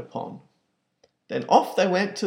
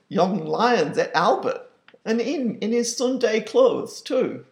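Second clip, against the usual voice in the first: aggrieved father; 7 semitones higher, 3 dB louder than usual. Acted. Pa complains to the manager.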